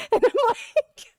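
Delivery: high laughing lead-in. Real, not acted. Laughing while telling about the driver. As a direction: laugh through the words.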